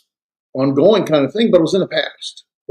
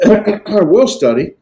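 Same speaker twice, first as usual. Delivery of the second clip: higher, louder, slow sarcastic "will"; 7 semitones higher, 5 dB louder, slower than usual. Shown as a demonstration. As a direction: drawl "I will study" with disbelief. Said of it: "I'm being sarcastic".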